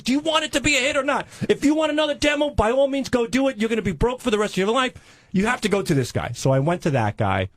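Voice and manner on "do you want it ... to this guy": much higher, fast mock-scolding agent; agent lecturing. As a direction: rattle it off like a pushy salesman. Agent voiced in angry retold quarrel.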